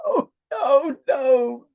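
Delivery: high, wailing "oh no"; mock wail. Mock wailing at the viewers' disbelief. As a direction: ham up a tearful wail.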